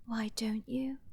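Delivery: taunting whisper. Acted. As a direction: breathe the taunt softly. Taunting villain.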